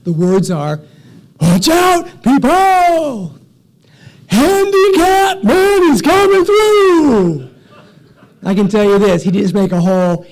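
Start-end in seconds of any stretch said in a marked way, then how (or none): 1.4-3.4 very high, loud holler; top-of-the-lungs warning yell; yelling at full lungs. Retold or imitated. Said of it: "starts yelling out these words over and over again at the top of his lungs". 4.3-7.5 high, drawn-out bellow; top-of-the-lungs street cry; yelling at full lungs. Retold or imitated. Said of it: "yelling out these words over and over again at the top of his lungs".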